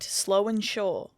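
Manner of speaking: usual speaking voice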